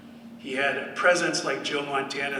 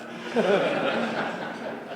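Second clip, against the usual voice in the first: light laugh; 7 semitones higher than usual. Real, not acted. Laughing at team's disagreement.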